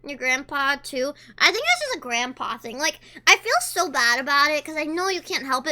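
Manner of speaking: ordinary talk, nothing marked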